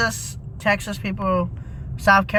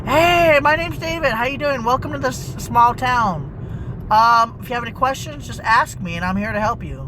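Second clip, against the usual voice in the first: friendly townsperson; 3 semitones higher than usual. Acted. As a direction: introduce yourself as an over-helpful local. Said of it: "so many nice people".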